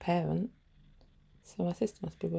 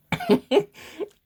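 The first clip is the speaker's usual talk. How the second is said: a much higher, loud shriek of laughter; 8 dB louder than usual. Real, not acted. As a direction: squeal with laughter. Laughing at her own story.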